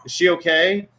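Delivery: high-pitched parental sympathy. Real, not acted. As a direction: comfort an upset little one. Parent soothing a crying child.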